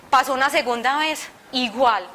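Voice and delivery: ordinary delivery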